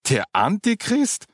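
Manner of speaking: high, slow, astonished cry; startled shout of surprise; surprised exclaim. A narrator's reading. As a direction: gasp out the name in disbelief. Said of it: "rief Markus überrascht aus".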